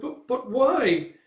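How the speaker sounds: high, slow, stammered plea; hurt sidekick. Retold or imitated. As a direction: protest in a wounded little voice. Rejected sidekick asks why.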